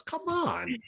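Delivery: a high, exasperated come on. Real, not acted. Frustrated fan complaining about Dottie quitting, exasperated. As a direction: throw up your hands at her. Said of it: "You seem really upset about this".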